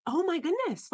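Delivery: mildly awed, pitch lifted; amazed realization. Retold or imitated. Retelling reaction to plea for help.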